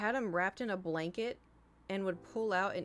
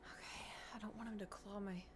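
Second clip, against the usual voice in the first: hushed whisper so the cat stays calm; soft unvoiced whisper near a nervous cat; 9 dB quieter than usual. Real, not acted. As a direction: breathe it out under her breath. Cat vlogger trying not to startle cat.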